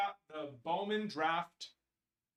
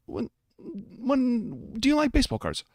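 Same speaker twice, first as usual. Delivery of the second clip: higher, louder put-on interviewer voice; mock interviewer; 9 semitones higher, 10 dB louder, slower than usual. Host asking himself a question in a goofy, higher voice.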